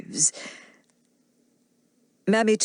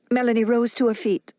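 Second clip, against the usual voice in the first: ordinary delivery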